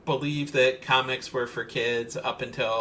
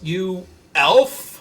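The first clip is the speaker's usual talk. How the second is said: mock indignant yell, louder and higher; higher, louder pretend outburst; retold yell; 5 semitones higher, 8 dB louder than usual. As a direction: yell it like a playful accusation. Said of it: "she yells".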